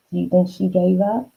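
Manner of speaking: ordinary delivery, nothing marked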